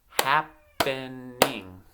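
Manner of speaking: "Happening" slowly split as hap, pen, ing; syllable by syllable. Shown as a demonstration. Teacher giving children a word to blend themselves, syllable by syllable. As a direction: clap out "Happening" in separate beats. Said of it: "I'm gonna clap out".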